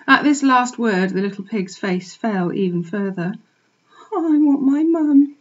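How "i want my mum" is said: very quietly. A narrator's reading. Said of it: "he said very quietly".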